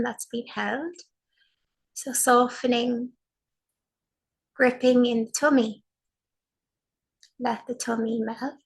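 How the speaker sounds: slow, spacious meditation voice with long pauses; guided meditation. Acted. Teacher guiding a sitting practice, slow and soothing. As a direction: guide the group into calm, one phrase at a time.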